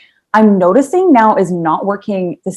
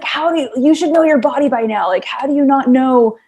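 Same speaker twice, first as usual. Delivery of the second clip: lightly mocked husband, pitched higher; exasperated husband; 6 semitones higher than usual. Wife retells her husband's questioning to friends, mock exasperation. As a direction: imitate a baffled husband, voice raised in pitch. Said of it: "my husband's like".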